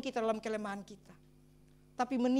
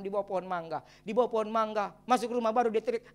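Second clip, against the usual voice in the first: ordinary talk, nothing marked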